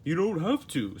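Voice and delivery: a cheerful, high Patrick offering to help. Acted. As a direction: jump in keen to help. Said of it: "said Patrick".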